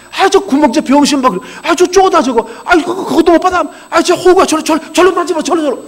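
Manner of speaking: loud, high jeering insults at a teammate; loud, high mock swearing at a missed ball; mock cursing. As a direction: heckle a clumsy player, loud and high. Student cursing at ball game.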